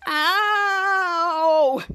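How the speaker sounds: long drawn-out "ow"; put-on wail of hurt; acted cry of pain. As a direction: howl it out in mock pain.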